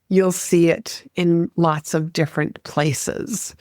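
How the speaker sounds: ordinary talk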